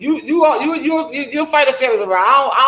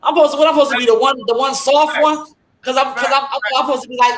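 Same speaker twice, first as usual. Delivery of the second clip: normal talking voice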